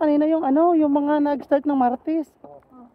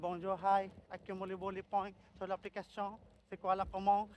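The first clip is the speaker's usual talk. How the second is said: robotic cashier script; 4 semitones lower, 15 dB quieter than usual. Retold or imitated. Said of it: "Kala mo robot".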